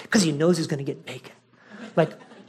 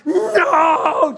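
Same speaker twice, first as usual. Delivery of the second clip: high, loud desperate yell; big voice; 11 semitones higher, 5 dB louder than usual. Retold or imitated. Owner running after his dog in flip-flops, big voice. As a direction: shout it after a runaway dog, second word stretched out. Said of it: "like, the big voice".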